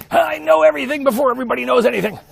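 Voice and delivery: high, loud, over-the-top crowing; hammed-up boast. Host bragging about his nineteen screens, high mock-manic boast. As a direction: crow it loudly like a manic know-it-all.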